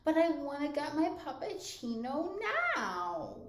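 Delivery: high, whiny princess voice; cartoon princess. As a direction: play a spoiled little princess. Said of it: "princess interior voice where she says".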